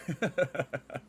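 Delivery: high, light chuckle; laugh. Real, not acted. Streamer amused by a crude potty joke, laugh. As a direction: break into a real giggle.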